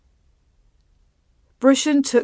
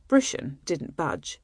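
ordinary delivery, nothing marked

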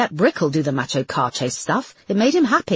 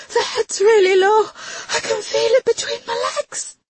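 high, crying in panic; panicked; 3 dB louder than usual. Acted. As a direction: sob it out in sudden panic. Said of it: "eyes wild with panic".

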